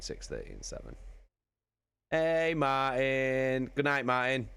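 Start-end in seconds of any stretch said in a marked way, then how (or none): 2.1-4.5 cheery goodbyes. Real, not acted. Saying goodbye to chat.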